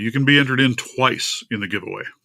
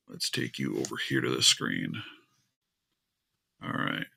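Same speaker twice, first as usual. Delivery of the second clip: normal talking voice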